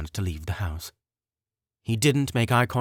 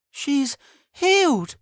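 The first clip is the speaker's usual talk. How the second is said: excited shout; 12 semitones higher, 3 dB louder than usual. Acted. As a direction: cheer it to the street. Crowd cheering.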